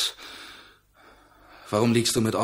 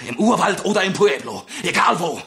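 grim threat; 7 semitones higher, 3 dB louder than usual. Acted. Narciso threatens Jaime.

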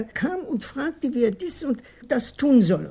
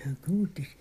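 reluctant whisper; 6 semitones lower, 8 dB quieter than usual. Acted. Informant gives in, whispered.

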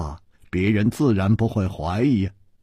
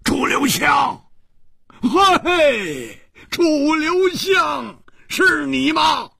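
high, slow calling out in shocked delight; overjoyed crying out of a lost friend's name; joyful cry; much slower than usual. A narrator's reading. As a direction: cry out a friend's name you thought was dead. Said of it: "又惊又喜，失声呼道".

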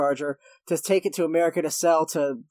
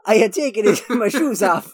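loud, higher mock Japanese accent; hammed-up Japanese accent, louder; 5 semitones higher, 7 dB louder than usual. Recapping a movie cop's line, mock Japanese accent. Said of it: "I'm gonna do a fucking racist accent".

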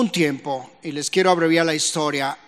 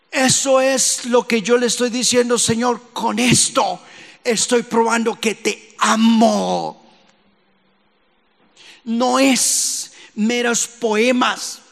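high, stretched-out preaching cries; urgent pulpit exhortation; forceful preaching; 7 semitones higher, 3 dB louder than usual. Real, not acted. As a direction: preach it with rising urgency, drawing out key words.

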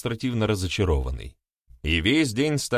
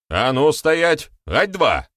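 louder, slow, higher barked halt order; barked command; 8 semitones higher, 6 dB louder, slower than usual. Acted. Blacksmith stops the two brawling women: barked order. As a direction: bark it like a drill sergeant. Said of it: "рявкнул он".

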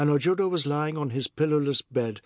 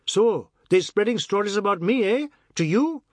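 raised, angry and higher, words thrown out loud; 7 semitones higher, 6 dB louder than usual. Acted. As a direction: flare up and demand it loudly. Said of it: "raising his voice said angrily".

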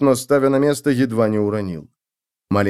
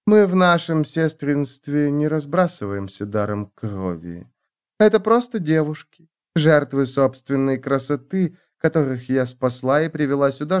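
higher-pitched countess voice, delicately explaining; silky countess; 4 semitones higher than usual. Acted. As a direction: play the countess choosing her words, with a small "hm". Voices the countess explaining her sisterhood. (голос графини, высокий, деликатно подбирает слова)

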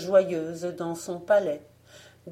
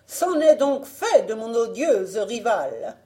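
high, loud exclamation of victory; wicked queen crying out in triumph; triumphant cry; 4 semitones higher, 6 dB louder than usual. A narrator's reading. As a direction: exult over a beaten rival. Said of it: "s'écria-t-elle".

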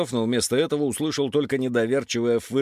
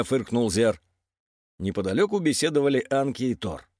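ordinary delivery, nothing marked

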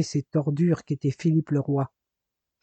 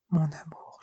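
breathy dying whisper, barely voiced; whispered moan; 8 dB quieter than usual. A wounded Jason moans to Marina in a whisper. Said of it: "gémit-il".

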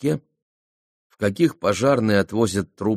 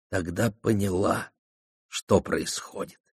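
ordinary delivery

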